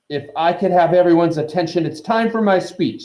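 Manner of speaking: pompous speaker over a crowd; loud announcement. A narrator's reading. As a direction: call the room to attention. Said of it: "Nearly Headless Nick loudly".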